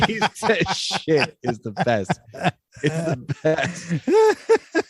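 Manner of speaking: high, slow, breaking up with laughter; cracked up over a favorite joke; laughing hard. Real, not acted. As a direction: talk through helpless giggles.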